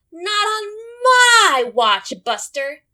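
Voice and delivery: high, loud, slow defiant cry; fierce shout. Acted. Mother squirrel shouting defiance at the bear. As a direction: stand up to the bully bear. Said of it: "said old Mama Squirrel".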